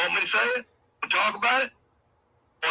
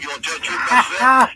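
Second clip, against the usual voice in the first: yelled, much higher and louder; angry shout; 9 semitones higher, 11 dB louder than usual. Real, not acted. Streamer yelling at Genevieve in the chat, angry shout. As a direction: blast the question at her.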